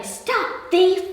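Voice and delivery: punchy acted yell; shouting. A narrator's reading. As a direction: call out after a runaway thief.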